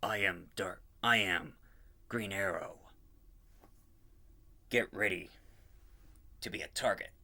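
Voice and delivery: a hint of a grim vigilante; dark hero voice. Announcing his Green Arrow, lightly voiced dark hero. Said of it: "I'm gonna be sounding more like".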